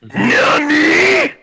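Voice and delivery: loud, high scream through a retro filter; a gritty filtered anime scream; screamed Nani with filter. Shown as a demonstration. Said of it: "with the retro filter".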